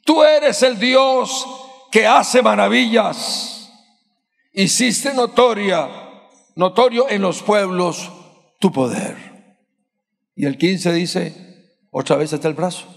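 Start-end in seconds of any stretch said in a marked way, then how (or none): 0.0-9.1 loud, high, slow proclamation; preaching at full force. Acted. Preacher proclaiming God's wonders to the church, loud. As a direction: call it out over the church.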